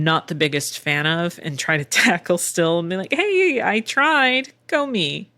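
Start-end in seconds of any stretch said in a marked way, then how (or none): 3.1-5.3 high opening, easing down to usual; a little mock cheer for yourself; self-cheering. Cheers self on after failing.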